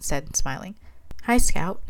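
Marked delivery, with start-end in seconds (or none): none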